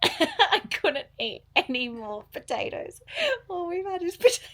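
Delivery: laughing through the words. Real, not acted. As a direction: giggle while you say it. Laughing about eating only potatoes.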